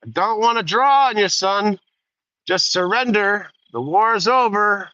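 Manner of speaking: high, loud drawling cowboy warning; Jude Law's gunslinger. Retold or imitated. Gunslinger warning the young albino rider, high and loud. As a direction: warn the kid off like an old gunfighter. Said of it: "Jude Law says to him".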